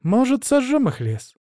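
high, slow suggestion; helpful dragon girl. A narrator's reading. Dragon girl proposes burning the elves' forest, hopefully. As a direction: sweetly propose it in a high child's voice.

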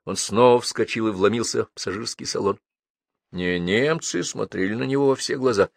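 plain reading voice

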